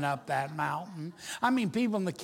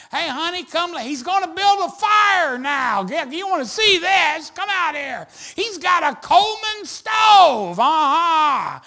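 high, loud, excited hollering, imitated; gawking neighbour; 10 semitones higher, 13 dB louder than usual. RV camper calling honey to watch the tenters, excited holler. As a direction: call your spouse over to the spectacle.

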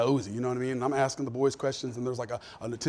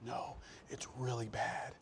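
hushed; 4 semitones lower, 15 dB quieter, slower than usual. Retold or imitated. Wife insisting quietly to husband.

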